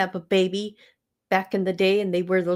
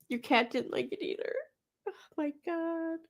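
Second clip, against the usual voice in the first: laughing-crying; 7 semitones higher, 11 dB quieter than usual. Real, not acted. Laughing remark at video.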